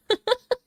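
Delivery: short high burst of laughter. Real, not acted. Streamer laughing at own family story, high burst.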